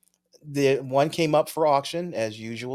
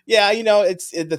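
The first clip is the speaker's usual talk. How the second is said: laughing; 10 semitones higher, 9 dB louder than usual. Real, not acted. Laughs at friend's joke.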